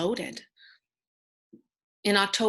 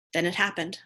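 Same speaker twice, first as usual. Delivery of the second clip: usual speaking voice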